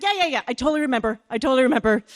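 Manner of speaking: loud flustered yes-yes-yes; flustered gush. Retold or imitated. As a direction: blurt it out, embarrassed. Embarrassed, covering quickly.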